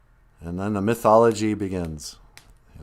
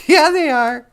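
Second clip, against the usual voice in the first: much higher, louder squeaky pet voice; pet's voice; 8 dB louder than usual. Pretend. Host greeting viewers on behalf of his pet, much higher cutesy voice.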